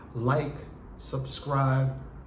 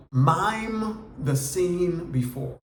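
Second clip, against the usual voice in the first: MIME drawn out slowly and pitched up; emphatic key point; 4 semitones higher, much slower than usual. Real, not acted. Teacher announces step two of the monologue method, slow and emphatic. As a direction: stretch out MIME high, then take the rest slowly.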